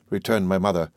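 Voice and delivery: usual speaking voice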